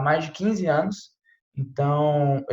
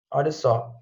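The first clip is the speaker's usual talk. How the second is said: ordinary delivery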